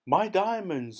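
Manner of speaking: high, loud wailing scream. A narrator's reading. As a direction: scream for the diamonds. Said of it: "screamed the Duchess".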